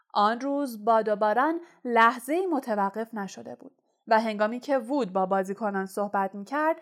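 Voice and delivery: plain reading voice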